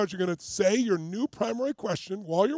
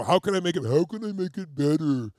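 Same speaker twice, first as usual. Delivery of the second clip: put-on scrambled, tongue-in-cheek send-up of an old question; teasing, mocking; 3 semitones lower, 3 dB louder than usual. Shown as a demonstration. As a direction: make fun of the phrase as you say it. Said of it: "Make fun of it, tease it".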